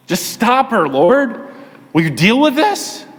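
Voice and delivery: high-pitched, exasperated appeal; fed up. Acted. Disciples begging the Lord to stop the woman, fed-up appeal. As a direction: plead irritably for someone to step in. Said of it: "They were fed up".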